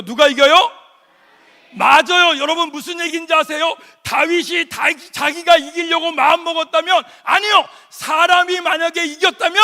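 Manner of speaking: loud fervent sermon delivery; preaching at full force. Acted. (힘차게 외치는 설교)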